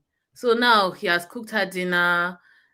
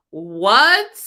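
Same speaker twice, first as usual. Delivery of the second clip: shocked; 8 dB louder than usual. Real, not acted. Shocked at the movie line.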